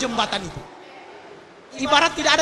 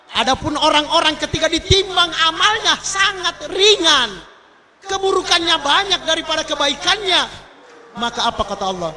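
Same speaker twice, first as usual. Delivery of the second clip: usual speaking voice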